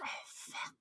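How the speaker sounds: hushed, breathy "oh, fuck" under the breath; whispered curse. Retold or imitated. Podcaster quoting the boardroom men, whispered dismay. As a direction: let it slip out as a stunned whisper. Retelling the same boardroom moment.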